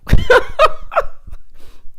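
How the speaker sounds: loud, high wheezy laughter; real squealing burst of laughter; high cackle. Real, not acted. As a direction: lose it laughing. Laughing at Aaron's question.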